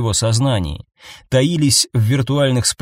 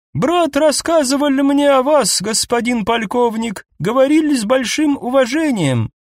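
too high elf voice; 13 semitones higher than usual. Acted. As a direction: speak in an unnaturally high voice. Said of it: "сказал эльф слишком высоким голосом".

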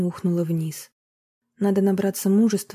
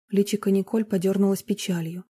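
ordinary delivery

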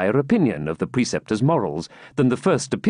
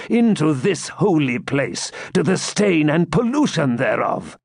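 high, slow commanding interrogation; Grand Master questioning his preceptor sternly; 6 semitones higher than usual. A narrator's reading. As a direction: demand an answer like a judge.

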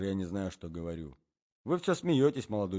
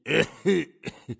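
put-on old man's cough; 6 semitones higher, 5 dB louder than usual. A narrator's reading. Old man coughing in bed.

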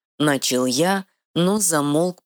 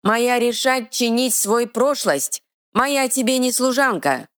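defiant troll; 10 semitones higher than usual. Acted. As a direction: spit it out like a simple stubborn troll. Troll declares.

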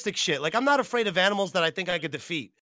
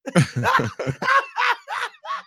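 high, loud burst of laughter; loud laughing shout; 3 dB louder than usual. Real, not acted. Streamer bursting out laughing loudly at a clip. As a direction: crack up loudly, high pitched.

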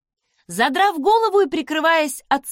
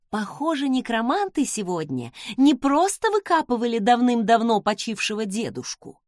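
usual speaking voice

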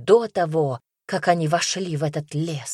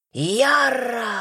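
high, drawn-out battle scream; piercing, furious cry; 6 semitones higher than usual. A narrator's reading. Furious cry rings through the forest, piercing scream. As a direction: let the name ring out long and high. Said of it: "разлетелся пронзительный, полный ярости крик".

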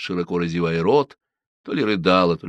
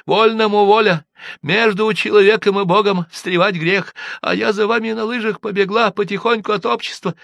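put-on high, louder peasant girl's voice; village girl explaining; 13 semitones higher, 5 dB louder than usual. A narrator's reading. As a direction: explain the run on skis earnestly. Manefa explains herself. (деревенская девушка объясняет)

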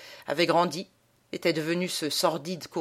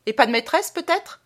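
sharp retort; 9 semitones higher, 6 dB louder than usual. A narrator's reading. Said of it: "L'autre ripostant".

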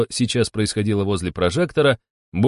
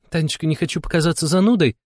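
higher, mildly defensive voice; apologetic self-justifying; 7 semitones higher than usual. A narrator's reading. Romashka excusing herself to Tanya, apologetic. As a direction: excuse yourself gently. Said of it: "тут же оправдалась Ромашка".

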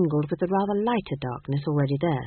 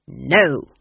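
louder blunt no; curt refusal; 4 semitones lower, 5 dB louder than usual. A narrator's reading. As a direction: cut her off flat. Granny refuses.

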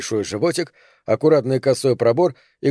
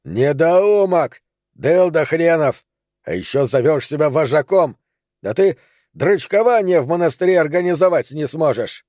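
stream of insults; 4 semitones higher than usual. Acted. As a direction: hurl the insults at him. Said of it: "поток оскорблений".

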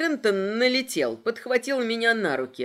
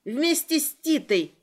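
higher-pitched, curt answer; cutting reply; 6 semitones higher than usual. A narrator's reading. Heroine cuts short Flo's protest, cutting reply. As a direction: cut off the objection. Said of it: "отрезала я".

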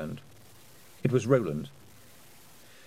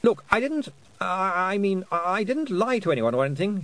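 put-on high, flustered boy stumbling into speech; blurted denial; 9 semitones higher than usual. A narrator's reading. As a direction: burst out defensively, tripping on the start. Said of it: "he blurted out".

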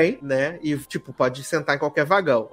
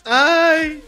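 mock hurt whimper; 9 semitones higher than usual. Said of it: "e ela fica".

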